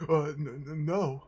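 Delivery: a cowed sergeant's stuttered refusal; stammering fear. A narrator's reading. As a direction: cower and stammer out the no. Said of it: "answered with a lowered head".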